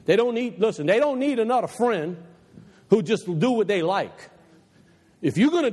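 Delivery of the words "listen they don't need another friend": ordinary delivery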